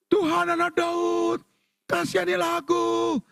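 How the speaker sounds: high, drawn-out pleading call, acted; crying out. Woman begging Jesus for mercy, imitated high cry. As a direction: call out slowly on a sustained high pitch. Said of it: "yang teriak-teriak tadi".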